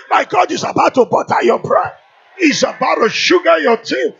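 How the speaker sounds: loud, high, building proclamation with a long held last word; full-force preaching. Real, not acted. Preacher declaring blessings over the congregation, full-force shout. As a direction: shout each blessing out slowly, punching every phrase.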